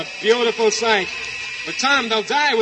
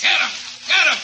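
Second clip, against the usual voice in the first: shouting; 6 semitones higher, faster than usual. Acted. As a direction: yell the order to attack. Convicts shouting to attack.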